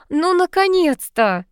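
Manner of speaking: high, drawn-out delighted squeak; squeal. A narrator's reading. Friend rejoices at Sanych's wedding, high squeal. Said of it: "пропищала она".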